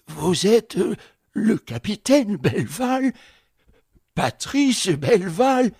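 plus aigu, étonnement qui monte; n'en croit pas ses oreilles; stupéfaction. Acted. Concierge stupéfait, répète. (le concierge découvre qui est Patrice, stupéfait)

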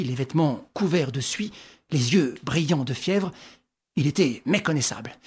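ordinary delivery, nothing marked

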